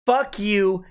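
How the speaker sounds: ordinary talk, nothing marked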